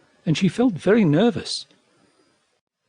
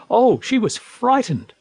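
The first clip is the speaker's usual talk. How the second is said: usual speaking voice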